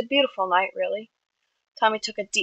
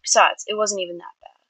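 usual speaking voice

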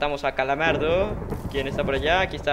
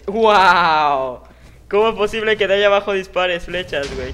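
louder, stunned; amazed outcry; 4 semitones higher, 8 dB louder than usual. Real, not acted. Gamer shot at from below, amazed outcry. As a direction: exclaim in disbelief.

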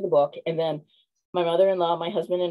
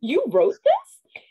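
surprised stranger; 11 semitones higher, 5 dB louder, much slower than usual. Retold or imitated. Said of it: "And he's like".

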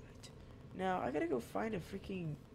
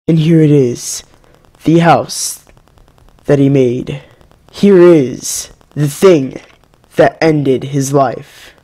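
slow, much louder solemn announcer; mock eulogy; 26 dB louder, slower than usual. Gamer mourning his own Minecraft death, hammed-up eulogy voice. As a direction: intone it like a grand funeral speech.

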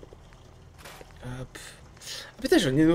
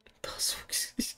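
soft unvoiced swear; whispered curse; 12 dB quieter than usual. Real, not acted. Gamer whispering a curse at loot. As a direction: breathe the curse.

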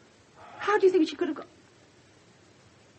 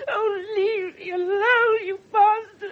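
slow, loud, higher cry through tears; sobbing outburst; 7 semitones higher, 10 dB louder, slower than usual. Acted. Mother screams at the pressing inspector, sobbing. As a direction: lash out as you break down sobbing. Mother breaks down under questioning.

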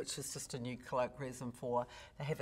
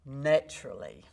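ordinary talk, nothing marked

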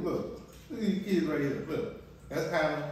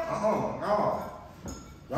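ordinary talk

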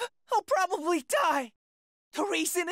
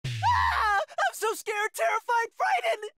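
terrified shriek; 2 semitones higher, slower than usual. Acted. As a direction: shriek like the demons are already here. Confesses terror, shrieking.

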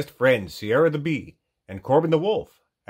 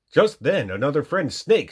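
plain reading voice

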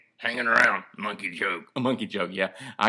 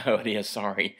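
ordinary delivery

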